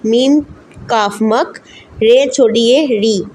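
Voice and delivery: मक and री spelled slowly letter by letter; slow spelling out. Shown as a demonstration. Teacher slowly spelling मकरी for students. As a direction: sound it out slowly for beginners. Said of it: "again हम पढ़ेंगे मीम".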